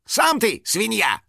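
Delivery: high, drawn-out indignant comeback; snappish retort. Acted. Finik answering an onlooker's insult, snappish. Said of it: "огрызнулся Финик".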